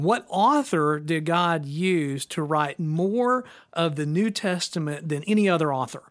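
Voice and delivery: slowed-down quiz question; slow and deliberate. Shown as a demonstration. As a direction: read the question out slowly. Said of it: "repeats question".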